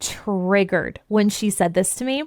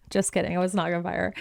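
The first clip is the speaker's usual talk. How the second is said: normal talking voice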